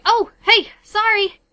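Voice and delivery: high, loud friendly shopkeeper call; florist calling out. Acted. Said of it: "you hear a voice say".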